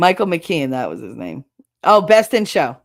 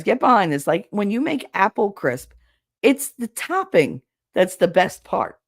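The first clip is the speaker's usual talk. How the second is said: normal talking voice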